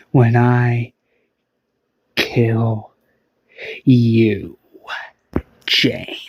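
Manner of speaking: low, slow deadly threat; low whispered death threat; menacing villain. Acted. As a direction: growl the threat low and cold.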